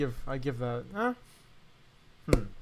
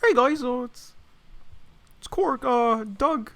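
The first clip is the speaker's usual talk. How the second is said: higher, louder froggy YouTuber greeting; hammed-up Kermit-style Korg; bad Kermit impression; 8 semitones higher, 6 dB louder, slower than usual. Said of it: "bad Kermit the Frog impression".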